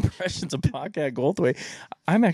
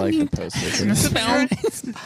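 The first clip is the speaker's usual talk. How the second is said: Bobcat Goldthwait impression; 7 semitones higher than usual. Shown as a demonstration. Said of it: "Do your best impression of him".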